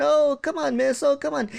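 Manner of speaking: high pleading whine; whiny begging. Retold or imitated. Pupil pleads with strict teacher, high whine. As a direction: beg the teacher like a child.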